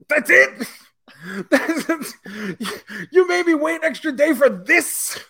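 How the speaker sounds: high, loud, disbelieving complaint; exasperated outrage. Real, not acted. Fan ranting to friend about the letdown, outraged and high. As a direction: whine it like you were cheated. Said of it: "outraged at documentary letdown".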